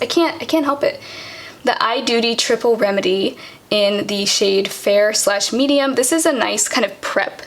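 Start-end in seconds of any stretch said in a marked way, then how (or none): 1.6-6.0 slow, careful product naming; consciously slow down as you read out the name; deliberately slower. Real, not acted. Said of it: "I'm gonna try to talk slower".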